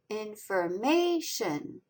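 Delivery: "Information" slowly, strongest on the third syllable; slow in-for-MAY-tion for "Information"; stress on MA. Shown as a demonstration. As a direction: enunciate "Information", leaning hardest on ma. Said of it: "The third syllable, may, is the strongest".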